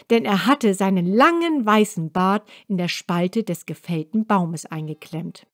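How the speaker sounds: ordinary delivery, nothing marked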